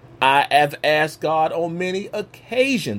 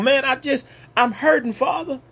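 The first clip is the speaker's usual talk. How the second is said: ordinary talk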